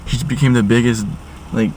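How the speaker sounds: usual speaking voice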